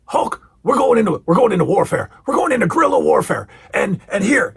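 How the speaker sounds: high, intense celebrity impression; rally a partner in an overexcited mock voice; Mister T. Mister T readying Hulk for warfare, fired-up impression. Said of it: "Mister T is going".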